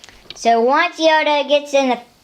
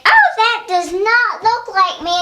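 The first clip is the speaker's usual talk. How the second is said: Boba Fett complaining; 8 semitones higher than usual. Pretend. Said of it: "He's like".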